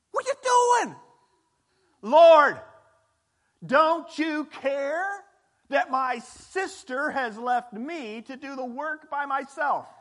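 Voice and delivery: high, loud, slow indignant outburst; exasperated Martha. Acted. Martha complains to Jesus about her sister, indignant and loud. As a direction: let Martha burst out at Jesus in frustration.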